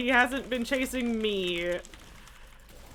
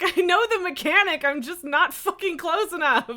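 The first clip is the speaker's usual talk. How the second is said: frustrated outburst; 7 semitones higher, 5 dB louder than usual. Real, not acted. Frustrated at failing mechanic.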